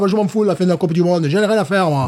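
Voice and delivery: southern French club supporter caricature; dismissive Jean-Mi character. Acted. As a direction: shrug off the tournament with mock indignation. Jean-Mi dismisses the World Cup. (supporter méridional parodique, faussement indigné)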